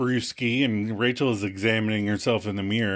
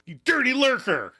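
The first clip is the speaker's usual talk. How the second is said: high-pitched comic accusation; mock outraged outburst; 12 semitones higher than usual. Phoebe running at Myrtle, mock outraged. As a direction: hurl it as a hammed-up insult.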